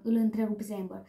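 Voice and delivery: plain reading voice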